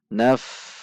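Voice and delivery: "Naf" dengan desir f panjang; f tidak tertahan. Shown as a demonstration. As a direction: alirkan napas pada f akhir "Naf". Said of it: "dibaca tidak tertahan". ("Naf" ditutup f yang mengalir)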